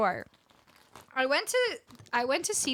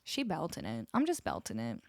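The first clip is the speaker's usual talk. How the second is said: much lower, quieter goofy quote; mock belting voice; 14 semitones lower, 8 dB quieter, faster than usual. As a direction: drop way low and mumble it proudly. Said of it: "I was like".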